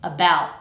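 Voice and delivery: ABOUT stopped short in the throat; no released t on ABOUT; glottal stop t. Shown as a demonstration. Teacher gives example words, final glottal stop. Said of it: "when the T comes at the end".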